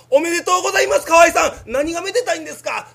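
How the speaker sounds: high and loud, hammed-up congratulation; mock celebratory official. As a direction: announce it like a prize. Official's cheery announcement.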